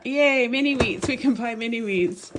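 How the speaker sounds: delighted yay; happy cheer. Real, not acted. Shopper finds Canadian-made cereal and cheers happily.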